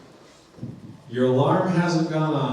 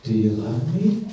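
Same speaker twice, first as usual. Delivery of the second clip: lightly acted low question; voice in bed; 6 semitones lower than usual. Spouse's voice comes again to someone in bed, low question. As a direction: pitch it down and lift the last word. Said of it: "that voice comes again".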